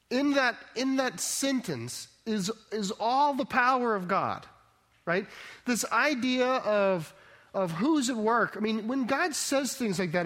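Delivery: soaring sermon voice; impassioned preaching. Acted. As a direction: preach it, building to the climax. Preaching at full force.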